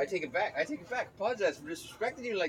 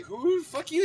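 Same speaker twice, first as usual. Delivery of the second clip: mock offended woman; 13 semitones higher, 6 dB louder, slower than usual. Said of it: "like a chick".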